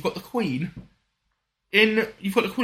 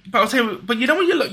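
ordinary talk, nothing marked

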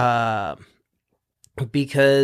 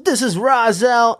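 higher, louder announcer-like hype shout; game music intro; 9 semitones higher, 4 dB louder than usual. Retold or imitated. Beatboxer recalling a snowboarding game's music, imitated intro. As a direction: imitate a track's vocal intro, pitched up. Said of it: "it started like".

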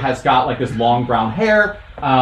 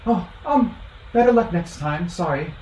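put-on small halfling girl; 5 semitones higher, 4 dB quieter than usual. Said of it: "she says".

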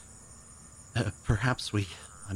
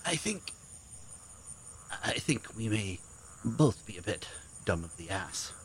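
quiet; 14 dB quieter than usual. Acted. Weak joke after gasp.

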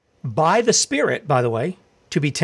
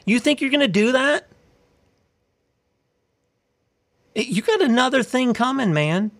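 higher, scornful rhetorical challenge to the wicked; taunting challenge; 4 semitones higher than usual. Real, not acted. Preacher taunting the bad guys with a scornful challenge. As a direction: dare them to try it.

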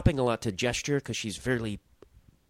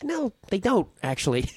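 indignant; 10 semitones higher than usual. Retold or imitated. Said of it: "I was like".

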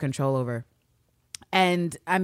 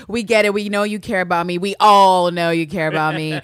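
mock snap; 8 dB louder than usual. Eve snaps at Nico, retold.

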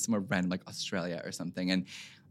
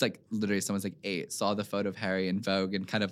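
usual speaking voice

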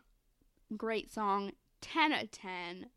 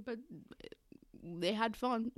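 ordinary delivery

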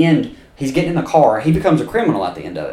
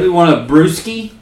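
mock bro voice; 6 semitones higher, 5 dB louder than usual. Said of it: "She goes".

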